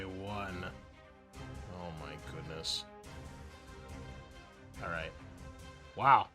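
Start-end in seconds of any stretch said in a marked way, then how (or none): none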